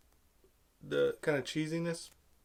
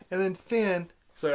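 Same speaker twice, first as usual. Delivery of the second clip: usual speaking voice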